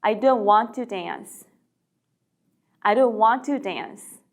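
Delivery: slow, "don't" stressed; slow model sentence. Shown as a demonstration. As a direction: slowly model the negative. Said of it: "싫습니다". (천천히 부정문)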